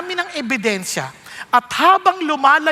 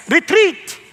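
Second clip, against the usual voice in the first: higher, urging voice of cowardice; 8 semitones higher than usual. Acted. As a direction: push him back like fear. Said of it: "cowardice says". (boses ng kaduwagan)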